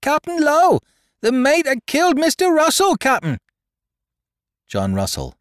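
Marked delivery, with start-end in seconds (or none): 0.0-3.4 piercing, very high alarm call; shrill cry. A narrator's reading. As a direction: shriek the news out to the captain. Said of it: "I caught the shrill voice".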